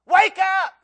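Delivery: booming shout from the man of the house; sudden shout up the stairs; loud wake-up shout. Retold or imitated. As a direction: yell it to wake the whole house. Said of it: "saying something like this".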